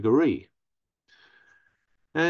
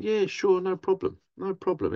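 mock breezy; 8 semitones higher than usual. Mocking dismissive enablers.

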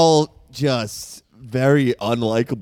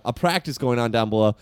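normal talking voice

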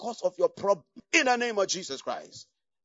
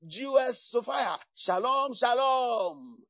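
street greeting; 2 semitones higher, 8 dB louder, much slower than usual. Retold or imitated. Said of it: "He says".

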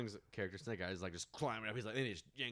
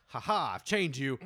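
gloating taunt; 8 dB louder than usual. Retold or imitated. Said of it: "he's like".

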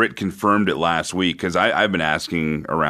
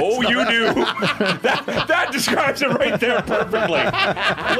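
laughing. Real, not acted. Laughing at the remark.

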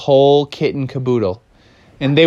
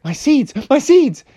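higher, fretting repeat; a touch of panic; 11 semitones higher than usual. Acted. Froggy fretting over his missing seeds, higher voice. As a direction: worry over the lost seeds.

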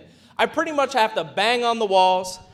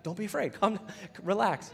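soothing reassurance; 2 semitones lower, 11 dB quieter than usual. Retold or imitated. Angel calming frightened Mary.

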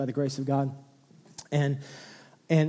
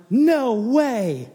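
much higher, slow, giddy disbelief; thrilled new dad; 10 semitones higher, 6 dB louder, much slower than usual. Retold or imitated. New dad marvels at his son's weight, high and thrilled. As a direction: stretch it out, over the moon. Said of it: "and I'm like".

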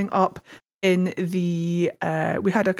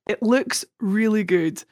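ordinary talk

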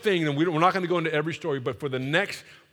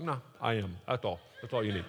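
low, soft, unbothered God; calm God voice; 5 semitones lower, 9 dB quieter, faster than usual. Retold or imitated. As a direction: answer like God, quiet and final. God voiced calmly in retold talk.

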